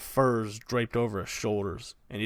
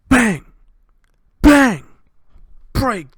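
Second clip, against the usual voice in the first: shouted, much higher, louder bang noises; 12 semitones higher, 14 dB louder, much slower than usual. Acted. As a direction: slam out the door blows. Door being smashed in.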